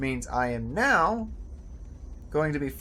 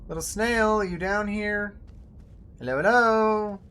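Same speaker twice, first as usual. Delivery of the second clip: higher, sing-song call to a little snail; calling a pet; 5 semitones higher than usual. Real, not acted. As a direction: coax a small creature out.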